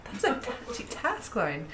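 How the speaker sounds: normal talking voice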